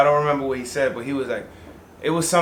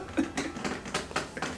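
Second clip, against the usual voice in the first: laughter; 14 dB quieter than usual. Real, not acted. Laughing after imitating dad.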